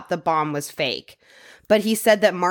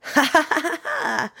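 mocking laugh; 8 semitones higher than usual. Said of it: "she said".

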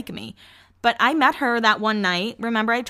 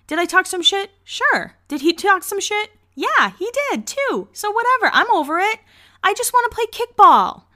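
higher, exasperated rant about the coworker; exasperated venting; 12 semitones higher, 4 dB louder than usual. Real, not acted. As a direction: throw your hands up, voice climbing higher. Venting about coworker excluding her.

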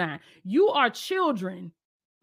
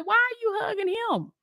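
put-on girl's voice; 8 semitones higher than usual. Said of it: "she said".

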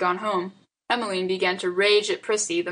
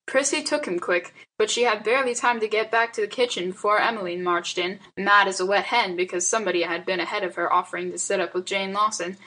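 ordinary delivery, nothing marked

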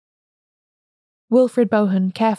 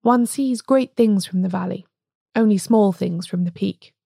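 ordinary delivery

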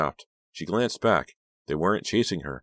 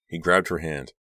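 ordinary delivery

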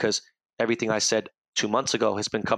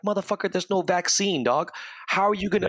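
exasperated retort; 8 semitones higher than usual. Retold or imitated. Retold heated argument with friend.

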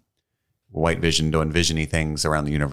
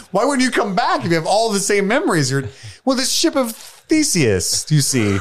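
high mock Vision voice; 4 dB louder than usual. Acting out the Vision scene.

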